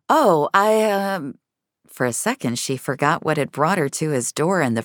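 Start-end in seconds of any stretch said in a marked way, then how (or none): 0.1-1.4 stumbling. A narrator's reading. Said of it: "stumbled over her words".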